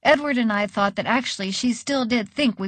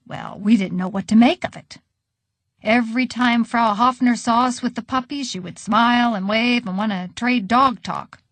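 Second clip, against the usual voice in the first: usual speaking voice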